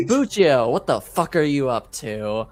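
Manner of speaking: usual speaking voice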